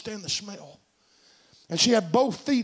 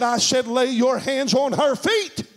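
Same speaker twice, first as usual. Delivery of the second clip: slow, raised-pitch repeated order; preacher voicing God's insistence; insistent command; 4 semitones higher, 3 dB louder, slower than usual. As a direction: repeat the order firmly, rising to the last word.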